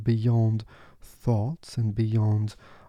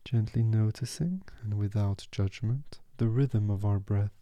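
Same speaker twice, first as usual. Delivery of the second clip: guided meditation. Acted.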